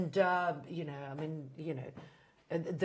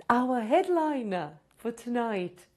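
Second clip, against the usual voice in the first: higher and much louder, stretched out, half sung like a compere; big announcer intro; 20 dB louder, much slower than usual. Acted. Host introducing the comedian, booming sung announcer call. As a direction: bellow the name to bring her on stage.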